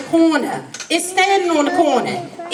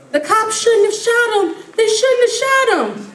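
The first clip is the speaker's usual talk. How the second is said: mocking outrage; 5 semitones higher than usual.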